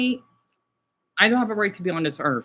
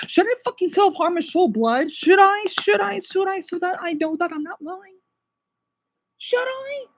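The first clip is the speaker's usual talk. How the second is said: high, strained pitch of real distress; upset, high-pitched questioning; distraught pleading; 10 semitones higher than usual. Real, not acted. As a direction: plead it high, at the edge of losing control.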